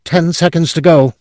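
high, tense countdown voice; nervous clerk. A narrator's reading. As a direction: count it down on edge.

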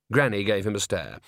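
usual speaking voice

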